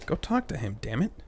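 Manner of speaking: ordinary talk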